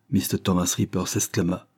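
plain reading voice

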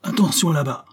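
startling cry. A narrator's reading. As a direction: call out a warning to those below. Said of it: "criant d'une voix ahurissante".

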